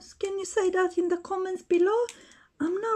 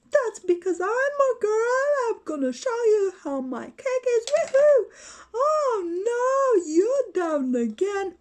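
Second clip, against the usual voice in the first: excited Pink Ranger; 4 semitones higher than usual. Pretend. Pink Ranger celebrating her kick, high squealing cheers. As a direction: cheer like you just won the fight.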